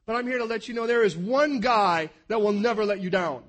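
loud, rising preacher's call; loud pulpit preaching; full-force preaching. Acted. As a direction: preach it out to the whole room.

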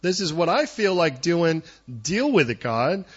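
loud defiant challenge; a loud mock-defiant cry to God; defiant shout. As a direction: throw it at God, loud and stubborn. Preacher voicing defiance at God.

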